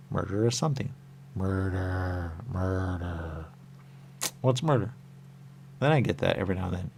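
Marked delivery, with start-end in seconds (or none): none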